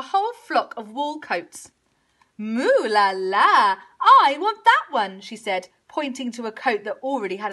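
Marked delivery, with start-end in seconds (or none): 2.4-5.2 slow loud moo rising to demand; covetous cow bellow; swooning cow. A narrator's reading.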